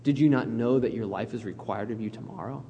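low, hushed, grave reproof; quiet rebuke. Acted. Jesus answering the rich fool, quietly grave. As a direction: drop low and soft to reprove. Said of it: "And then Jesus said".

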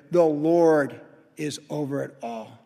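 ordinary talk

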